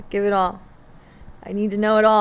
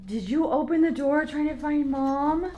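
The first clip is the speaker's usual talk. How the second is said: lightly raised voice coaxing the dog; asking the dog gently, pitched up; high pet talk; 7 semitones higher than usual. Real, not acted. Owner asks her dog about the door, light pet voice.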